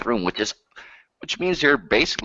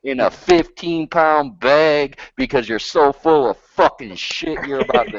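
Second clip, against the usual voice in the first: angry rant; 7 semitones higher, 3 dB louder than usual. Real, not acted. Ranting insult.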